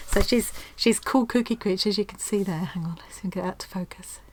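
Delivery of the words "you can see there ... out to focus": hushed, slow whisper. Real, not acted. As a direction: breathe it out in awe. Quiet awe over the parcel.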